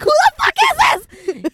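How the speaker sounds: high, very loud shriek, drawn out; retold freak-out at a caller; shouted retort. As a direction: shriek it in outraged alarm. Acts out alarmed phone reaction.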